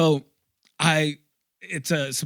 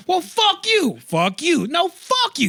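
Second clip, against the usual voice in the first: high-pitched mock-furious swearing; volley the insult back and forth, indignant; heated insult exchange; 14 semitones higher than usual. Passenger and driver trade insults, mock furious. Acted quarrel trading insults.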